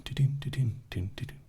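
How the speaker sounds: usual speaking voice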